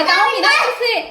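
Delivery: high, loud telling-off; playful threat. Real, not acted. Threatens child who kicked her.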